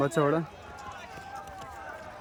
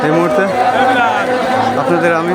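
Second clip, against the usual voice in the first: loud announcer voice, louder; race commentary; 7 semitones higher, 11 dB louder than usual. Acted. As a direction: call it to the crowd. Opening horse race commentary. (ঘোড়দৌড়ের ধারাভাষ্য)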